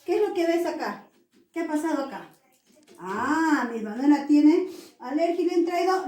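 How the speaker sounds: normal talking voice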